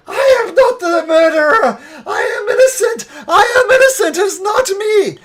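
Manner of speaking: high-pitched, full-volume wail of protest; screaming ghost. Acted. Ghost of a hanged woman protesting innocence, screaming. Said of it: "it starts screaming".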